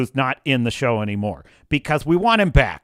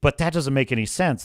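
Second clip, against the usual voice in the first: normal talking voice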